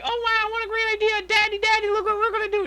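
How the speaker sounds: very high squeaky cartoon voice; cartoon background character. Kids showing Daddy their great idea, squeaky cartoon voice. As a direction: squeal like an excited little cartoon figure. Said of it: "Smile and Friends background character".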